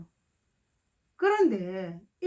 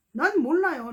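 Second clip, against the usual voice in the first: ordinary talk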